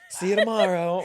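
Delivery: high, loud, laughing goodbye; breezy celebrity exit line; mock star farewell. Cohost playing Jennifer Coolidge leaving, breezy goodbye. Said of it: "she would just walk off".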